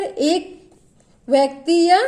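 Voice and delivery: ordinary delivery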